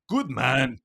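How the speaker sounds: imitated Irish accent. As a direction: say it like an Irish customer. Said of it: "such a great fucking Irish accent".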